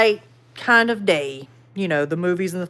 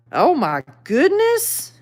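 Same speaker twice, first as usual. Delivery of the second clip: slow, high oh my goodness; amazed; 7 semitones higher, slower than usual. Real, not acted. Reacting to a viewer's creature sighting, amazed. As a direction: marvel at the story.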